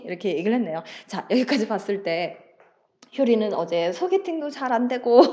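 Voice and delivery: ordinary talk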